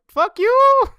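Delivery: very high, loud cartoon shout; mock battle yell. Imitating a heroine mid martial arts kick, mock shout.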